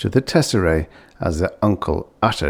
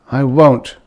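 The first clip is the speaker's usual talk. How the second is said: vow; 6 semitones higher, 7 dB louder than usual. Acted. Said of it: "he vowed".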